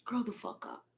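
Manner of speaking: hushed blunt whisper. Real, not acted. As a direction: mutter it under your breath. Aside after mocking.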